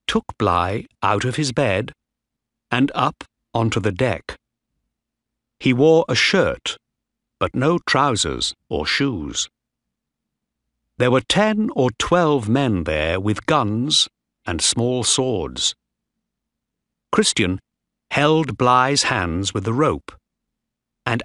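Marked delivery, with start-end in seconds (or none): none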